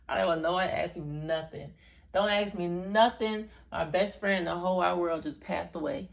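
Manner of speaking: loud, insistent retold protest; forceful, heated plea to be left alone; emphatic warning. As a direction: warn everyone off, drawing out the key words. Acting out fighting back tears, warning people.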